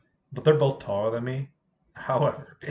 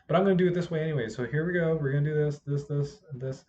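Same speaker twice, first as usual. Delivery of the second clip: fast pushy teacher voice; 4 semitones higher, faster than usual. Retold or imitated. Retold teacher insisting.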